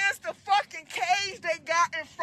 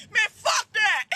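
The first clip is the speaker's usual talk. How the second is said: mock shout; 6 semitones higher, 5 dB louder than usual. Yelling at police.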